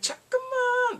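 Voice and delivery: very high, drawn-out call; mock pleading call; high-pitched calling out. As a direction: call after someone in a high voice. Acted calling after her brother.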